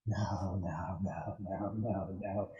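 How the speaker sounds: tearful mother. Acted. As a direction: whisper the nos through tears. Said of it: "the mother is just in tears".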